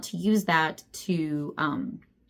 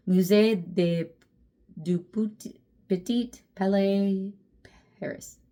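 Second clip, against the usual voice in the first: put-on a slow, hesitant try at a French accent; sounding out the French place name slowly with a stab at the accent; mock French accent; slower than usual. Reading a French museum name off a book cover, in a hesitant mock French accent. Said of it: "pretending I can read French".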